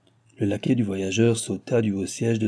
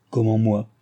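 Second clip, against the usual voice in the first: ordinary delivery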